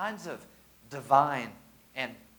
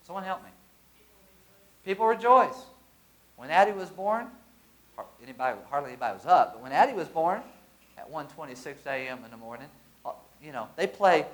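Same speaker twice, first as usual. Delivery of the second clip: ordinary talk, nothing marked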